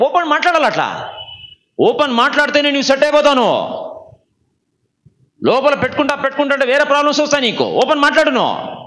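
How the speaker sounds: high-pitched, driving exhortation; forceful preaching. Real, not acted. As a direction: press the congregation hard to open up. Exhorts the congregation to speak openly. (ఉద్వేగంతో గట్టిగా ప్రబోధం)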